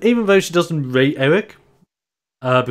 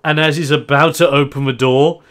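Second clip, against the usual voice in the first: ordinary talk, nothing marked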